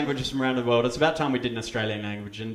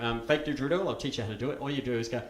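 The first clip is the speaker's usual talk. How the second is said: ordinary talk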